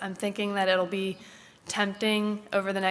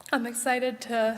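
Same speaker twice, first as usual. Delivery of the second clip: choked up; 4 semitones higher than usual. Real, not acted. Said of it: "I did not know I was gonna get emotional".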